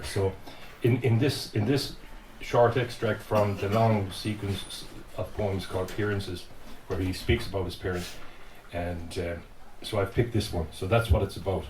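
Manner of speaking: ordinary talk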